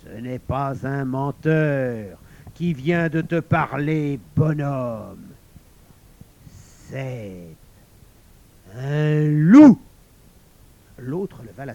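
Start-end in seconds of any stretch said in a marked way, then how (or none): 0.0-5.2 slow, louder, roughened stranger's voice; suddenly rough voice. A narrator's reading. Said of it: "la voix soudain rugueuse". 6.9-9.8 pause, then a loud howled LOUP; wolfish reveal. A narrator's reading. Stranger reveals he is wolf.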